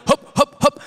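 short, high-pitched hoisting calls; mock marching grunts. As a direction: imitate carriers heaving in rhythm. Imitates guys hoisting the sheet.

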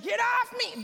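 high-pitched mock shout; brusque Chicagoan. Neighbor to the woman hugging him, brusque mock shout. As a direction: push the hugger away with your voice. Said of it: "Very much Chicago".

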